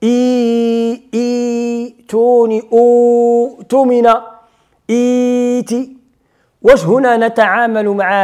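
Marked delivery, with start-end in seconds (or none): 0.0-6.0 إيتوني and أوتمن drawn out slowly, the vowel after the hamza stretched; elongated demo. Shown as a demonstration. Teacher demonstrates Warsh lengthening of the hamza. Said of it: "تتولي همزة بعدها مد".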